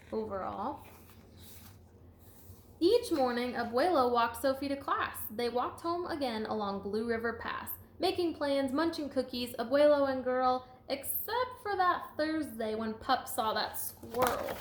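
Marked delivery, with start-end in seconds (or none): 2.8-14.6 lively, sing-song storybook read; reading to children. A narrator's reading. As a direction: read it out to a class with bounce. Teacher reading picture book aloud.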